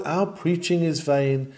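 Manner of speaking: ordinary delivery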